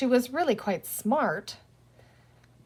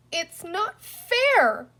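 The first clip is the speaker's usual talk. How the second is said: put-on much higher, slow childish wail; 9 semitones higher, 3 dB louder, much slower than usual. Little Patsy whining when a toy is taken, slow. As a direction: whine like a wronged little girl. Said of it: "Patsy would wail".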